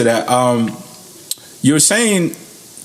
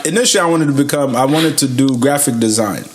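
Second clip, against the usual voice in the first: ordinary talk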